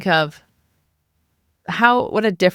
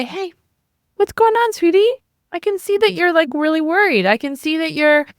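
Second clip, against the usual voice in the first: put-on much higher, sweet mom talking to an eight-year-old; nice mom voice; 7 semitones higher than usual. Shown as a demonstration. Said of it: "this is called the nice mom voice".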